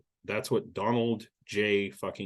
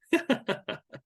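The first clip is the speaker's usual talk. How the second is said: high burst of laughter; real high-pitched laughing fit; 13 semitones higher than usual. Real, not acted. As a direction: laugh out at the absurd claim. Laughs after reading the quote.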